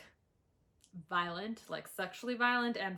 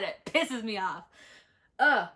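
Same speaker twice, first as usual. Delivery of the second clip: usual speaking voice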